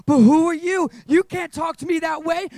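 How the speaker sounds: high, loud mocking challenge; taunting shout. The enemy taunting a powerless believer, mocking shout. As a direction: sneer it as the enemy. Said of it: "the enemy's gonna say".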